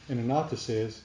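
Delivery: ordinary delivery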